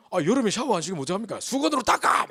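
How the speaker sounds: high, loud frantic orders; frantic shouting. Retold or imitated. The grandfather shouts at the daughter-in-law in a high, loud panic. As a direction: bark it like a panicked old man. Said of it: "뭐 이러는 거예요".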